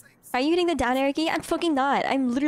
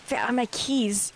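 put-on slow vampire accent, W turned to V; attempted vampire accent; 3 semitones lower than usual. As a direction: have a go at a vampire accent, slowly. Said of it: "I was trying to sound like a vampire".

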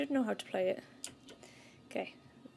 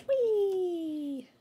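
long high squeal, sliding down; loud playful cry of riding along; gleeful whee; 9 semitones higher, 8 dB louder than usual. Real, not acted. Playful cry while moving in game.

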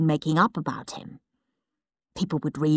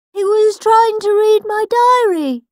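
much higher, prim little brother voice; indignant Peter; 14 semitones higher, 6 dB louder than usual. Acted. Peter ordering Henry out of his bedroom, high and prim. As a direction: shoo him out, high and prissy. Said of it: "said Peter".

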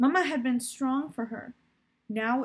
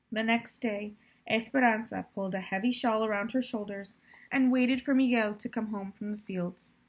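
plain reading voice

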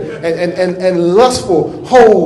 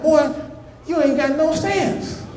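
high, slow mock coach; preacher imitating his football coach, high and slow; 8 semitones higher, 6 dB quieter, slower than usual. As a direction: play the old coach scolding a boy. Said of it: "He's like".

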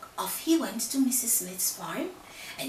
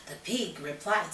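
plain reading voice

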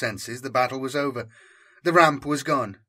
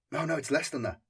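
ordinary delivery, nothing marked

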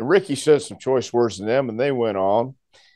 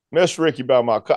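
usual speaking voice

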